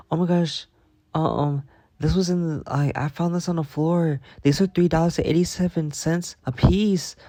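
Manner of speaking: high, fretting mock voice of a coworker; imitating fussy coworker. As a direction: pitch it up and fuss like Tina over pennies. Imitating Tina fussing over liners.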